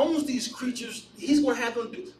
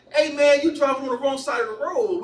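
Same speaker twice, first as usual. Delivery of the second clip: loud, higher teasing shout; calling out; 4 semitones higher, 6 dB louder than usual. Retold or imitated. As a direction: call out to the driver, loud on the opening. Friend teasingly calls to trolley driver.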